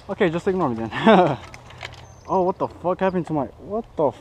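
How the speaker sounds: usual speaking voice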